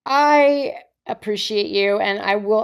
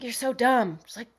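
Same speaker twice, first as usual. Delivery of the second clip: affectionate scolding; 4 semitones higher, 4 dB quieter than usual. Retold or imitated.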